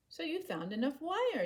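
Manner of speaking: a hint of an admiring younger sibling; lightly voiced sibling. A narrator's reading. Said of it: "Yufulu said".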